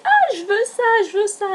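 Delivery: high-pitched gushing self-quote; excited plea. Retold or imitated. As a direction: squeal it like a kid begging for a treat. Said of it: "j'ai dit".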